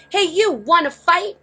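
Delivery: very high, loud bully squeak; grouchy ladybug challenge. A narrator's reading. Grouchy ladybug daring the whale's tail, high and loud. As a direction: taunt the tail, cocky and shrill-free but high. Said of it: "the grouchy ladybug said to the whale's tail".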